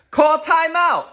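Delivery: frustrated fan barking orders at the TV; yelling at the coach. Real, not acted. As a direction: shout it at the sideline in disbelief.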